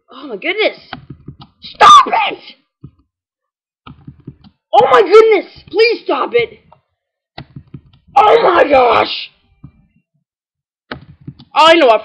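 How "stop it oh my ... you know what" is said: loud frustrated yelling at fast game text. Real, not acted. Player yelling at fast-scrolling game text, frustrated shout. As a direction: yell at the screen to stop.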